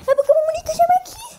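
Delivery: fast high voice. Acted.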